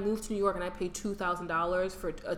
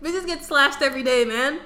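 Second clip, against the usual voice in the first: heated rant; 5 semitones higher, 7 dB louder than usual. Real, not acted. Heated rant about city danger.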